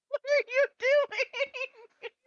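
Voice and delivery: high laughing disbelief. Real, not acted. DM laughing at a player's joke, laughing.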